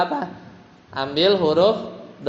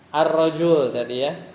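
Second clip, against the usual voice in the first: ordinary talk, nothing marked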